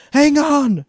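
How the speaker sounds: very high, loud call; shouting. A narrator's reading. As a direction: holler it over the bar noise. Mom shouting over bar noise.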